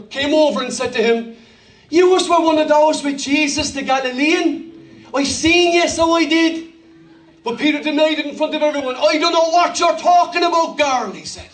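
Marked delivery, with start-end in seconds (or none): 1.9-6.7 higher-pitched Dublin accusation; Dublin accent. Acted. Servant girl confronting Peter in the courtyard, Dublin accent. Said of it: "we say they're from Dublin". 9.0-11.1 higher-pitched Cork denial; Cork accent. Acted. Peter denying it to the servant girl, Cork accent. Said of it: "let's say Peter's from Cork".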